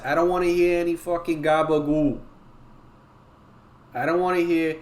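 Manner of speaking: put-on Brooklyn Italian accent. Streamer warning the class about Discord posts, Brooklyn Italian accent. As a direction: warn them off in thick Brooklyn Italian. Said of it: "can you do a Brooklyn Italian accent?".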